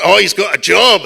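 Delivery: loud, lilting Scottish dad; imitating a Scottish father; dad's Scottish accent. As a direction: brag in a Scottish burr. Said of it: "That's how he spoke to you".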